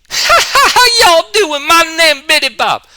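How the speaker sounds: very high, slow, strained hillbilly shout; squeaky yelled hillbilly character voice; Billy Bob voice. Shown as a demonstration. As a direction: belt it out like a cartoon hillbilly. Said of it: "I do a voice called Billy Bob".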